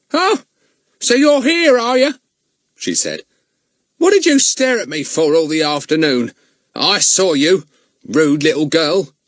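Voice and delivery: high, loud, scolding crone voice; bad-tempered old woman. A narrator's reading. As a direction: snap at the rude girl. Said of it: "in a very bad temper".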